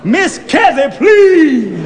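high, loud calling out. A narrator's reading. Narrator calls out to Miss Kezzy, loud and high. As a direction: call across the car to her.

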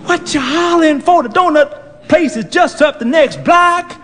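put-on high-pitched elderly woman; old woman voice. Old lady fussing at the young driver, high and pert.

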